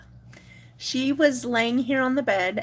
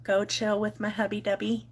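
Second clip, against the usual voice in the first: normal talking voice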